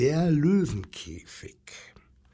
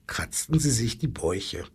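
ordinary delivery, nothing marked

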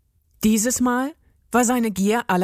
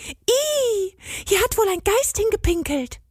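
put-on much higher, disgusted girl's voice; girl cursing; 12 semitones higher than usual. A narrator's reading. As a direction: squeal it out, grossed out. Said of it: "fluchte sie, und ihre zarte Stimme". (hohe, angewiderte Mädchenstimme)

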